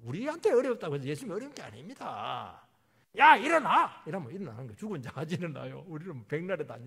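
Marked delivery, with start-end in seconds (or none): 3.2-4.0 high, very loud acted command; wake-up shout. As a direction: shout to rouse a sleeper. Acts out waking the sleeper.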